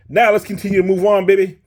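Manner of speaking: fired-up host pushing on; hyped up. Real, not acted. Hyped transition to next part.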